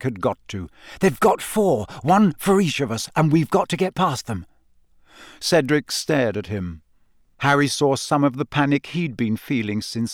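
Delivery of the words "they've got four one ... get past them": higher, urgent rush of words; speaking quickly. A narrator's reading. Harry warning Cedric before the professor appears, quickly. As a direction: get it out before anyone comes. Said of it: "speaking quickly".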